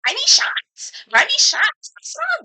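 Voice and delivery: high, eager, over-the-top; mock tipsy voice. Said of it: "She was like".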